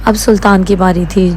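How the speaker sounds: ordinary delivery, nothing marked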